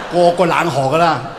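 ordinary talk, nothing marked

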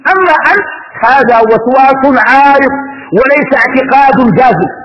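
ordinary talk

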